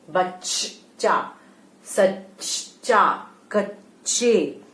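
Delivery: बच्चा, सच्चा, कच्चे said very slowly, doubled ch held; drawn-out example words. Shown as a demonstration. Teacher slowly reading example words to children. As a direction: stretch each word, leaning on the double च. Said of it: "आधा च plus च".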